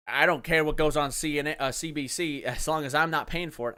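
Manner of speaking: loud, mocking Southern twang; mock a careless taxpayer in a Southern accent. Mocking CBC critics in a loud Southern accent. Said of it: "why a Canadian has a southern accent".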